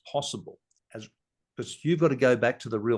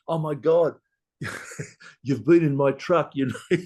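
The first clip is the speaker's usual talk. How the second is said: higher, louder, laughing disbelief; excited, laughing exclamation; 5 semitones higher, 7 dB louder than usual. Retold or imitated. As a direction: blurt it out, amazed and laughing. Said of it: "someone said".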